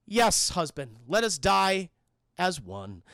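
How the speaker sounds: mock-grave line with stiff pauses; stilted solemn wife. Zooey agrees to die with her husband, stiff solemnity.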